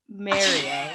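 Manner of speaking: "Mario" in a careful TV-presenter accent. Shown as a demonstration. Podcaster demonstrating for her co-host, careful TV voice. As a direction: say "Mario" trying to hide the New York accent. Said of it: "In my T V voice, I would say".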